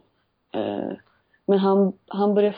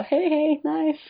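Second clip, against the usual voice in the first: fake cheerful greeting; 9 semitones higher, much slower than usual. Barista turning back to a customer with a fake smile, high and chirpy. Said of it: "jag ler jättestort mot dem".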